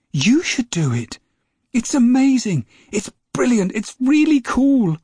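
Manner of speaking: put-on a boy who can't contain his excitement; excited young James. James urges grandma to fly.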